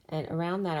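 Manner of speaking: usual speaking voice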